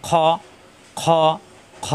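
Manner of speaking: খ thick and rounded, no open a; heavy kho. Shown as a demonstration. Teacher drills the letter, heavy rounded খ. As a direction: rasp খ from the throat with an o-like vowel. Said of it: "আকার প্রকাশ পাবে না".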